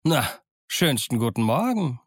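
cheerful Silver. A narrator's reading. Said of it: "von bester Laune strahlte".

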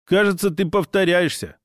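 usual speaking voice

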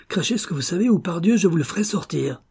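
high-pitched, irritated threat; cursing threat. A narrator's reading. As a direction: threaten to force the truth out. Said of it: "murmura-t-il avec un juron".

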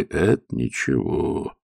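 slow, soft reassurance; reassuring. A narrator's reading. Old admiral reassuring the flustered young officer. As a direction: gently put him at ease. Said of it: "ободряюще улыбнувшись".

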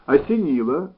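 prompt, firm ОСЕНИЛО with a lift; quick reply. A narrator's reading. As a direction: fire ОСЕНИЛО straight back as an answer. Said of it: "быстро сказал Саинян".

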